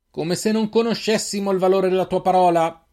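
high, sustained scornful shout; shouted retort. A narrator's reading. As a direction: yell back across a distance. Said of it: "urlò Firan in risposta". (replica urlata)

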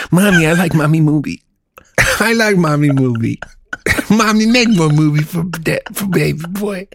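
a hint of a whiny little boy, laughing; baby boy voice. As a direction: beg Mommy like a toddler, giggling. Playing a child begging Mommy.

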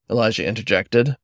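usual speaking voice

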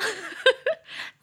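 loud high embarrassed laugh; laughing at the shameless sentence; high giggle. Real, not acted. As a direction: giggle at your own cheek. Laughs at own line.

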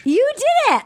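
high delighted praise; excited cheer. Real, not acted. Host cheering the cohost's accent attempt, excited. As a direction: cheer her on again. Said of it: "I need to hear it again".

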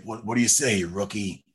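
low, quiet Judge Dredd; Karl Urban's Dredd. Acted. Dredd evaluating his rookie partner, low terse impression. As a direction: test the rookie in a dropped, terse voice. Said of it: "So he's like".

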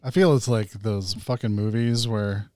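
normal talking voice

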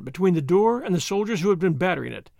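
ordinary delivery

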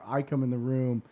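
ordinary talk

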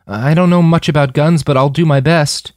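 voiced high and loud as a determined girl; high determined girl's voice; young woman's voice. A narrator's reading. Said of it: "she said".

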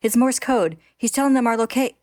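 high, loud warning broken mid-word; urgent shout, cut off. A narrator's reading. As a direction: shout the alarm and stop short. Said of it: "He cuts himself off".

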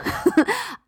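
bright high giggle; high burst of laughter. Real, not acted. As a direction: laugh at her own roar. Laughing after her roar.

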